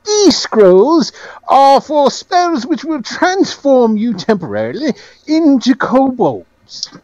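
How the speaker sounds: high, slow, grand presentation; Penholt. Acted. Game master plays Penholt.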